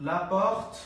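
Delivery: "porte" with a throaty R, like "pokht"; French pronunciation. Shown as a demonstration. Teacher reading the sentence out, "la porte" with guttural R. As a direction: sound the R of "la porte" back in the throat. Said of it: "এটাকে যেভাবে পড়তে হবে সেটা হচ্ছে পর্ট".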